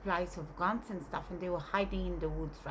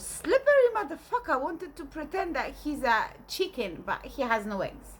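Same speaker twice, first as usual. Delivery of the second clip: indignant rant; 6 semitones higher, 4 dB louder than usual. Retold or imitated. Grandmother ranting about chicken.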